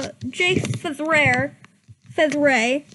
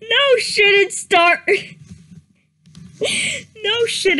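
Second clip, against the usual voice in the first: louder, higher, cracking with laughter before rushing the rest; laughing through the line; 4 semitones higher, 4 dB louder than usual. Real, not acted. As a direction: try to read it but keep breaking up. Rereads the line while laughing.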